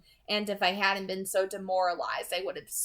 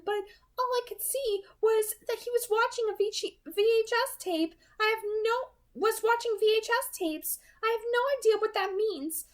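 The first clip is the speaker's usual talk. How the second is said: higher female voice describing a vision; Alice's voice. A narrator's reading. Alice describing the tracker's rooms, higher voice. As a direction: recount the vision, voice raised high.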